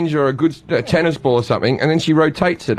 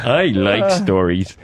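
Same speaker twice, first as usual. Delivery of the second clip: low, slow cartoon simpleton; deep and dopey, drawn out; Homer Simpson; 8 semitones lower, slower than usual. Comic closing his Homer bit, deep slow impression. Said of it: "do Homer Simpson".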